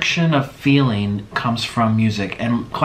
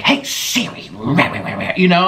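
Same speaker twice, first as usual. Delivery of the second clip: put on thick; much slower than usual. Shown as a demonstration. YouTuber showing how she plays for laughs, put on thick. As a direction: ham it up for laughs. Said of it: "I'll put it on thick".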